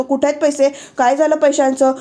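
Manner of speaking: normal talking voice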